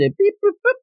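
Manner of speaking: high, chirpy beep-boop-boop; sing-song beeps, way up high; keypad beeps. Retold or imitated.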